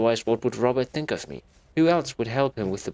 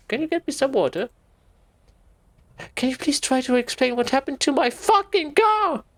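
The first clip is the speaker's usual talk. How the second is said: much higher, irritated demand; annoyed Anne; 13 semitones higher, 4 dB louder than usual. Acted. Anne demanding answers from the groundkeeper, high and irritated.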